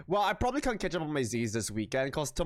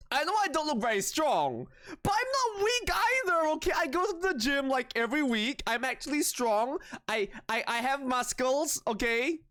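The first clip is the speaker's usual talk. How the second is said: indignant insistence; 9 semitones higher than usual. Acted. Streamer tells chat she is not weak, mock indignant. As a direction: insist you're strong, mock offended. Indignant claim she's strong.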